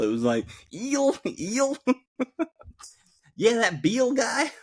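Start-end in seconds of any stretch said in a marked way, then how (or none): 0.7-1.8 "Eel" for words in -ill, pitched very high; mimicked mispronunciation. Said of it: "a word ended with I L L". 3.4-4.5 "Beal" for Bill, pitched very high; mock his accent with a long ee in "Beal"; mimicked mispronunciation. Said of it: "a word ended with I L L".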